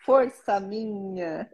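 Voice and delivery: slow, devout reading of a psalm; prayerful psalm reading. Acted. Mother reading her daily psalm to viewers, slow and devout. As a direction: read each verse slowly like a prayer.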